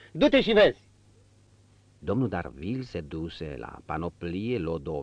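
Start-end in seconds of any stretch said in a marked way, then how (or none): 0.1-0.9 1 curt order. A narrator's reading. Marquis orders valet.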